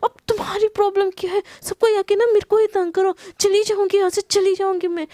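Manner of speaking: high, loud furious outburst; angry shout. Acted. Wife shouts angrily while storming out, high and loud. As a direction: blow up at whoever is there. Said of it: "गुस्सा हो रही होती है".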